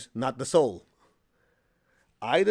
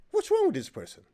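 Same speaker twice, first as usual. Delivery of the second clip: incredulous; 13 semitones higher than usual. Retold or imitated. Teacher baffled retelling phone call.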